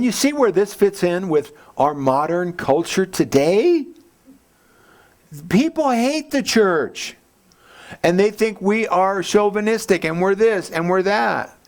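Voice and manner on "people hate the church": high, very slow stressed line; emphatic point. Acted. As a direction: land it slowly and hard. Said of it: "emphatic sermon point".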